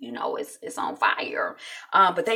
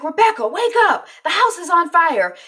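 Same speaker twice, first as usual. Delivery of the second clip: acted urgent call, high and loud; urgent alarm; 7 semitones higher, 5 dB louder than usual. Jack waking Rebecca in a house fire, urgent. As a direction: imitate raising the alarm.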